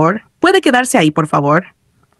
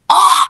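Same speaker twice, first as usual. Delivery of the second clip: high-pitched "oh"; startled exclamation; 11 semitones higher than usual. A narrator's reading. Player meets the character again, startled exclamation. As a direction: exclaim high, caught off guard. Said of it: "exclamó".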